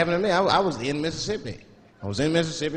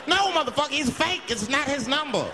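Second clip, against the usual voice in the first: fed up and yelling, pitched way up; exasperated yell; 11 semitones higher than usual. Real, not acted. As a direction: shout it at a dumb caller. Exasperated retort to moviegoers.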